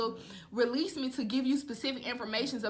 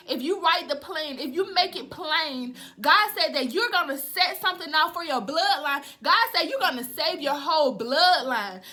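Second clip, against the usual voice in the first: raised, emphatic prophetic preaching; fervent preaching; 3 semitones higher, 4 dB louder than usual. Real, not acted. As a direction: preach it with urgent, driving conviction. Preaches prophetic word with rising force.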